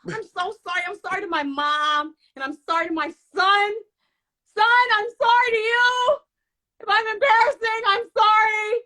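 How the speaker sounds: high, loud fake crying wail; mock sobbing. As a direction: sob it out like a soap opera. Mock tearful apology.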